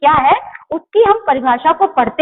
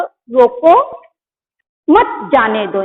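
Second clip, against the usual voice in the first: रोको rising, a clear stop, then lower मत, जाने दो; pause after रोको; 3 semitones lower, much slower than usual. Shown as a demonstration. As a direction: split the sentence right after रोको. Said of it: "यहाँ पे अल्प विराम लगा है".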